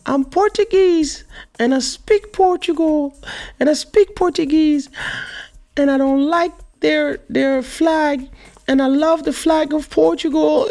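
mock whiny high-pitched child protest; imitation of a tearful little girl; almost crying. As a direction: wail it high and loud, imitating an upset little daughter. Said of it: "she started almost crying".

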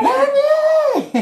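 high urgent call of the name; calling out in alarm; retold shout. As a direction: yell her name across the house. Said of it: "¿Qué?".

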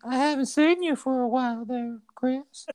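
put-on high, creepy old man on the phone; Herbert voice. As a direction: coax him like a creepy old neighbor. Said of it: "that's a really good impression".

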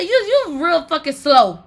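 loud, high-pitched self-scolding; mad at herself. Retold or imitated. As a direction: scold yourself out loud in exasperation. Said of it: "I got mad at my damn self".

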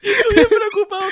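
laughing so hard it sounds like crying. Real, not acted. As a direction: squeal it through laughter. Hysterical laughter at story.

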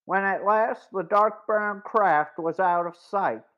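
usual speaking voice

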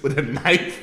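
loud, high, through laughter; losing it on the punchline; laughing. Real, not acted. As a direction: blurt it out while cracking up. Cracking up at own scenario.

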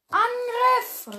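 high, loud charge call; full-throated war cry; battle cry. Acted. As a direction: yell the attack order. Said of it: "rief er".

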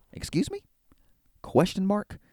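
high, questioning squeak of disbelief; small, high-pitched mock confusion; mock bafflement. Mock bafflement at surprise attack.

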